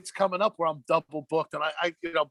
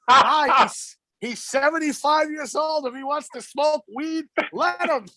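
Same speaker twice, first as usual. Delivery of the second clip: defending grandpa; 8 semitones higher than usual. Retold or imitated. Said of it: "And I'm like".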